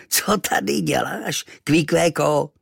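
high, slow, exaggerated character voice; exotic tattooed native. A narrator's reading. Stranger quizzes boy found in his room, exaggerated. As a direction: draw out the odd question.

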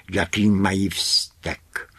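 ordinary delivery, nothing marked

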